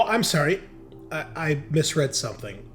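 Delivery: usual speaking voice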